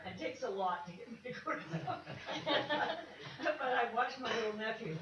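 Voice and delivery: laughing through the words. Real, not acted. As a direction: giggle while talking. Laughs at her own remark.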